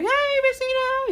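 high, slow sing-song lady's greeting; playful mock neighbor greeting. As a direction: imitate the cheerful neighbor lady. Voicing his older neighbor.

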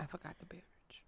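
fast hushed whisper. Real, not acted. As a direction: mutter it under your breath. Whispered aside.

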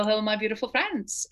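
ordinary talk

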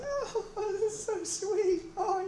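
gushing wife. Retold or imitated. Pastor plays the delighted wife in his joke, high and giggly. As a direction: coo like a flattered new bride. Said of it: "she was like".

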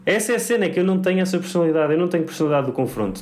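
high and fast, flustered; rushing through it, flustered; agitated rant. Real, not acted. Heated rant about the waiter.

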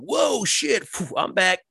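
high-pitched startled whoa, then relief; startled stoner. Retold or imitated. Blurting to his friend after a scare, high and startled. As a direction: yelp up high, then sigh it's over.

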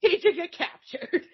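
high, slow, laughing disbelief; mock incredulous. Character questioning his captured lover, high mock disbelief. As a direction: demand it high and drawn out, amused.

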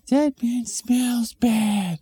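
put-on high, slow little kid voice; a small child whining. Kid whispering to mom about a smelly man, high childish voice.

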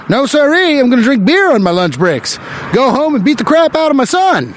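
high, hammed-up old-timey tough guy; 1920s factory worker. Comic imagining a 1920s factory worker, mock gangster voice. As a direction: ham up a "see"-saying 1920s worker. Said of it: "talked like that".

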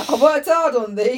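high, slow words through laughter; laughing. Real, not acted. Laughing as she jokes to her friend.

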